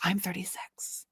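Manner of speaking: whispered aside, unvoiced; a private whispered confession to the viewers. Real, not acted. Viewer chat aside, whispered to herself. Aside after grandma at thirty-six.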